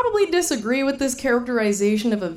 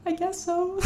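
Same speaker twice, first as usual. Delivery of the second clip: very high, much softer grudging reply; reluctant agreement; 9 semitones higher, 6 dB quieter, slower than usual. Retold or imitated. Answering husband's cheerful question, soft grudging reply. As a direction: give in half-heartedly. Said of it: "And I was like".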